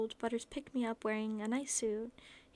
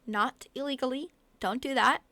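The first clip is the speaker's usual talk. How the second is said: mock stern; 2 semitones higher, 8 dB louder than usual. Real, not acted. Joking warning to viewers.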